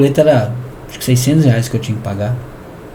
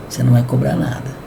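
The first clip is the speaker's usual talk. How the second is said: inner voice; 3 semitones lower, 5 dB quieter than usual. Retold or imitated. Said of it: "O Espírito Santo no meu ouvido".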